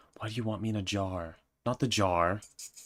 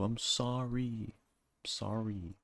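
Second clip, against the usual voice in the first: fading apology; 3 semitones higher, 8 dB quieter, much slower than usual. Acted.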